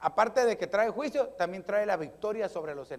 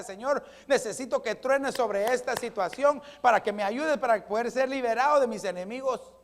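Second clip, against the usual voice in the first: raised, higher pleading voice; fervent prayer; 5 semitones higher, 6 dB louder than usual. Shown as a demonstration. Congregant begging God to thunder over the situation, fervent. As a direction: cry out to God with force.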